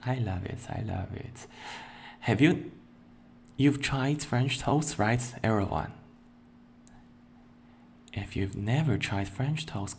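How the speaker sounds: ordinary talk, nothing marked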